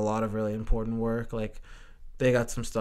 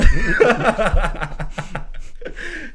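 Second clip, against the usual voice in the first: loud, high burst of laughter; hearty laugh; 9 semitones higher, 8 dB louder than usual. Real, not acted. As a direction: crack up at your own joke. Cracking up at own joke.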